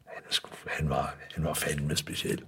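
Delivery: breathy, near-silent marvelling; hushed whisper. Real, not acted. Awed whisper about the late pipe maker, hushed. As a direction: whisper it, almost under the breath.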